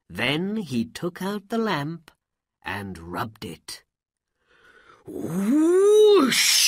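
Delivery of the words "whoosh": loud, high rising whoosh; magic whoosh. A narrator's reading. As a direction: voice a big sudden magical whoosh. Magic lamp rubbed, sound imitated.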